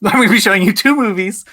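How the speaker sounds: mock your own announcement, laughing and hurrying it; laughing self-mockery. Host repeats his own game explanation, laughing self-mockery. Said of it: "Why did I have to say that?".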